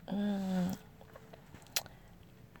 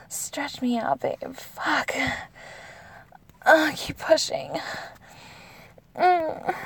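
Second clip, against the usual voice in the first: higher, louder, breathless cries building up; passionate moaning; 4 semitones higher, 8 dB louder than usual. Acted. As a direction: let it build to a climax, crying out. Builds to loud climactic moaning.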